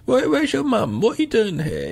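high, surprised questioning; mock surprise. Pharmacist surprised to see the girl, high questioning.

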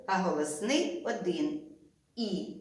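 ordinary talk, nothing marked

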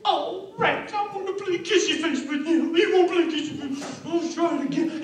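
high falsetto woman, put out; hammed-up pouting wife in falsetto; thwarted temptress. Potiphar's wife turned down by Joseph, pouting falsetto. Wife complains after refusal.

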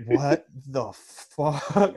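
very slow, drawn-out disbelief; stunned disbelief. Retold or imitated. Man retelling waking up to a wild music video, stunned. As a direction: stretch each word out in retold astonishment.

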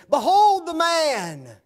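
high, slow, loud proclamation; proclaiming loudly. Acted. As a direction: announce him to the mob. Pilate's proclamation acted out.

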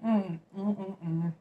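chewing while humming; humming through food; mouth full. Real, not acted. Seen 3: Lips closed, chewing after a big sandwich bite; holds sandwich.

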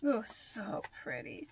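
slow, hushed admiring whisper; awed whisper. Real, not acted. Fan admiring a band member's picture, awed whisper. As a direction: breathe it out softly in awe.

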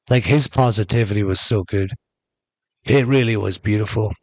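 normal talking voice

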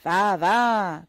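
loud, impatient bark; guard shouting at the mother to get moving; irritated shout. Acted. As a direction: snap at her to move on. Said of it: "cria le gardien avec irritation".

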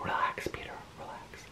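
barely voiced aside to oneself; very soft, breath-only mutter; whispered self-soothing. Real, not acted. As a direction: whisper it as if calming yourself down. Calming self after tripping over words.

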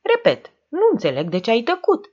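high, quickened, pressing demand; insistent. A narrator's reading. Hagianu presses Diamantopol about his silence, insistent. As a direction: push hard for an explanation. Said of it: "insistă Hagianu".

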